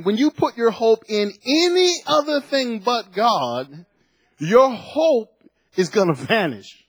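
full-voiced sermon build; preaching. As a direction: preach it out to the church, rising.

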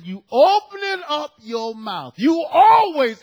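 loud, slow, drawn-out rebuke; imitating a pastor's booming telling-off; acted angry scolding. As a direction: lay into the gossip, stretching the words. Acts out pastor scolding gossiping sister.

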